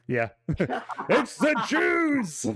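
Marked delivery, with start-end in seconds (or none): 1.1-2.5 slow, high, hammed-up proclamation; mock dramatic exclamation. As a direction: draw it out high and theatrical.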